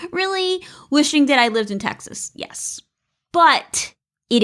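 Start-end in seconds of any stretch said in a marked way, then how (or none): 0.0-0.6 high, softer, breaking into a laugh; amused, laughing. Real, not acted. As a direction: tease the listener, then laugh. Joking about her own sarcasm.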